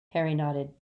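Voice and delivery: usual speaking voice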